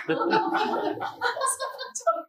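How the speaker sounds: high soft laughter; trailing giggle. Real, not acted. Speaker laughs.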